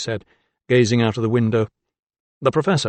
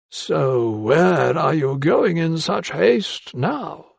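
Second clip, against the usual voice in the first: put-on higher, slow, dry voice of an elderly doctor; slow higher old-man voice, probing; 5 semitones higher, much slower than usual. A narrator's reading. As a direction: let the old mentor needle him, slowly.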